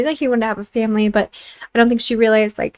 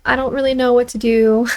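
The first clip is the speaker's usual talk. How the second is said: usual speaking voice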